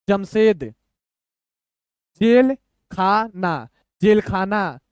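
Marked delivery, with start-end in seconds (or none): 2.2-3.7 slow, pauses between the parts; broken into parts. Shown as a demonstration. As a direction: sound it out piece by piece. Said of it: "दो दो अक्षर तोड़ तोड़ के".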